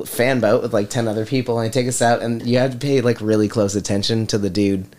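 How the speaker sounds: normal talking voice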